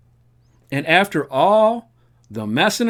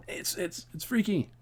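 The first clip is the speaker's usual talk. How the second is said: hushed unease; 4 semitones higher, 13 dB quieter than usual. Real, not acted. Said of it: "freaked out".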